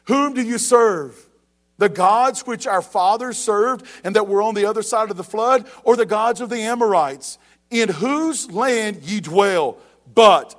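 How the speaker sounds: slow, loud scripture proclamation with a hard final But; emphatic scripture reading. Acted. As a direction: read the verse like a challenge. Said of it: "watch what he says".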